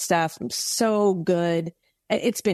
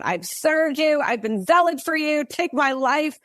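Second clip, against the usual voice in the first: crying out to God; 7 semitones higher than usual. Retold or imitated. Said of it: "Elijah kind of crying out".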